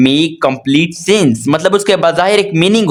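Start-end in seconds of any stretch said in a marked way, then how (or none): 0.1-1.5 "make complete sense" drawn out word by word; slow stressed phrase. Shown as a demonstration. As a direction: lean on "complete sense" slowly. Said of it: "लेकिन that". ("make complete sense" को धीरे और ज़ोर देकर)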